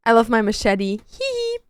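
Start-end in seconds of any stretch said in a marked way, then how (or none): 1.1-1.6 high giggle. Real, not acted. Giggle after loving machete.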